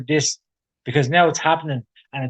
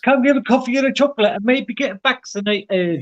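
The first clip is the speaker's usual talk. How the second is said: mock promo lady; 9 semitones higher than usual. Mocking the vaccination ad.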